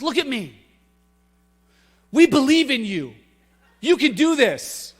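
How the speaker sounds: silly pep talk. Retold or imitated. Brother grabbing his sister's neck, mock-earnest pep talk. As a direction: ham up a coach's pep talk. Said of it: "he was being silly".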